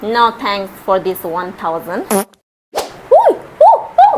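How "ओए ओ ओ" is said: very high, loud hooting cries; high-pitched yelling. Acted. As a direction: yelp out sharp, piercing calls. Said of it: "इतने जोर जोर से मतलब चिल्ला रही हो".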